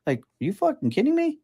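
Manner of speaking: squeaky, fast cry of disbelief. Real, not acted. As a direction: throw up your hands in outrage. Ranting in disbelief at JD.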